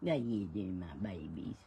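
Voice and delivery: soft, low cooing at the pets. Real, not acted. Woman coos to her pets in baby talk. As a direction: coo to the animals like babies. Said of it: "talks in like a baby voice to their pets".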